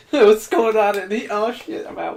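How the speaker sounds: slow, high and laughing, playing the naive victim. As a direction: ham up a clueless horror victim, giggling.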